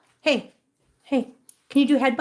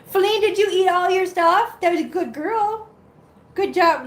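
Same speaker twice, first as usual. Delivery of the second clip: high-pitched pet praise; high, doting pet-talk; praising a pet; 7 semitones higher, 3 dB louder than usual. Real, not acted. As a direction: fuss over the pet, pitched up.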